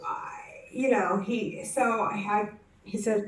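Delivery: ordinary talk, nothing marked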